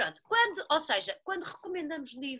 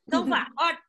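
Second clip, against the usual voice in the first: calling for order; 3 semitones higher, 8 dB louder, faster than usual. Real, not acted. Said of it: "ela está a mandar pedir ordem".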